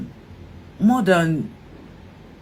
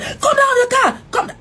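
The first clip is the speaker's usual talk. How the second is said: shouted order. Retold or imitated. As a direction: bark it at the driver. Officer ordering her out.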